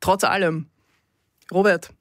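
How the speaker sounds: usual speaking voice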